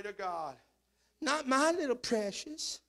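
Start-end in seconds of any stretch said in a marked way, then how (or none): 1.2-2.8 doting parent mock.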